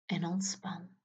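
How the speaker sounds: soft breathy whisper; whispered release. Acted. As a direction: let it go in a whisper. Release cue after tensing.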